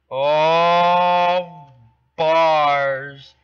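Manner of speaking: loud excited Oh, bars; excited shout. Real, not acted. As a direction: hype up your own rhyme. Praising his own rhyme.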